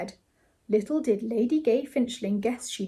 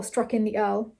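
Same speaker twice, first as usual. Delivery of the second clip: plain reading voice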